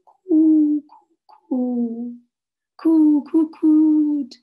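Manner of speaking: high, loud, drawn-out coos like doves; dove cooing. Acted. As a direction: coo like a flock of doves. Doves cooing to their sister.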